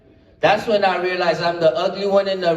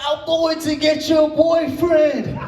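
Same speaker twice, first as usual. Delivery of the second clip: higher, slow mock yell back; mock scream; 8 semitones higher, slower than usual. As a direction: shout it high and drawn out, running off. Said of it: "I screamed back".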